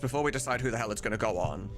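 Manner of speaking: ordinary delivery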